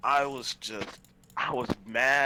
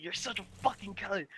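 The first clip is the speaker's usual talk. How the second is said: imitating own rage; 9 semitones higher than usual. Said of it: "I was like".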